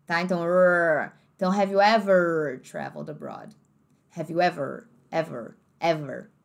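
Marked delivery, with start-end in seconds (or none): 0.4-1.1 a long rolled "rrr" like a countryside r; drawn-out r. Shown as a demonstration. Teacher showing students the American r sound. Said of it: "puxado pro R do interior". 1.5-6.3 slow, low American English, hard r on "ever"; American accent. Shown as a demonstration. Teacher drilling American r for students. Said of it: "No inglês americano".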